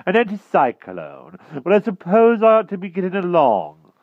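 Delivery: high, loud, blustering knight; flustered King Pellinore. A narrator's reading. King tries to leave the magician, flustered. Nervous king excuses himself.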